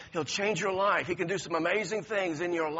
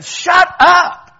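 loud, high angry snap; harsh rebuke; 13 semitones higher, 19 dB louder than usual. Retold or imitated. Crowd rebuking the beggar to silence him. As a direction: shut him down hard. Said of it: "They said".

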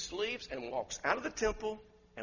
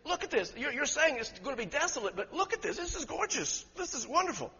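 higher, laughing, marvelling voice; awed disciples; 8 semitones higher than usual. Retold or imitated. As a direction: gush over the buildings. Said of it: "the guys were saying".

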